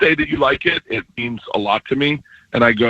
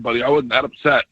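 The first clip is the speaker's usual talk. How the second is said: normal talking voice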